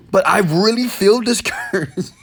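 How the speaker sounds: a hint of a self-pitying fan; mock whiny complaint. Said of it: "You might say".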